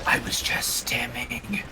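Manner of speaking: high, slow whispered apology; breathy mock ASMR whisper; whispered asmr role play.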